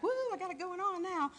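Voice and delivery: high, pumped-up woo; cheering yourself on after a call; mock triumph. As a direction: celebrate like you just nailed it.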